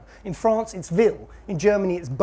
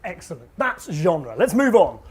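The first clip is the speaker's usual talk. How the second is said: usual speaking voice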